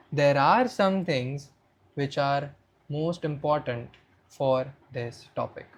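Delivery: slow, measured delivery with pauses; slow for impact. Shown as a demonstration. Coach shows speakers how to add impact, speaking slowly. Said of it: "This is how you speak slowly".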